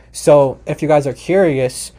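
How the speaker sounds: usual speaking voice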